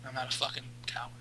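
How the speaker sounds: hushed, fast muttered aside; whispering. Real, not acted. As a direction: mutter it under your breath.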